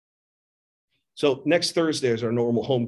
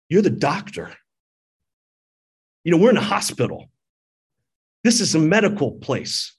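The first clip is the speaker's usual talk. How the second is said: raised, louder, higher protest at the doctor; retold angry outburst; 5 semitones higher, 5 dB louder than usual. Retelling snapping at the doctor about his babies, angry and raised. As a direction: hammer back in disbelief, a bit hammed-up. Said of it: "You know, I was angry".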